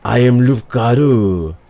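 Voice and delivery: grandly announced in mock French accent; French villain staking his claim; bad French accent. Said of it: "I cannot do a good French accent".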